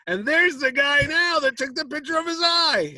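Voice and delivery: high, loud call across a room; mock announcing yell. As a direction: imitate someone gleefully pointing a man out to friends. Ford pointing him out to friends.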